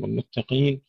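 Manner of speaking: ordinary delivery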